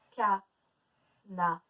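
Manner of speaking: "canari" very slow, syllable by syllable; teacher counting out the syllables of "canari"; split into syllables. Shown as a demonstration. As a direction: pause after each syllable of "canari". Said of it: "On fait les syllabes".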